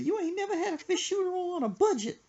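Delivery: put-on high, fast, squeaky old lady voice. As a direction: mimic her fussy old lady voice quickly. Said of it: "She said".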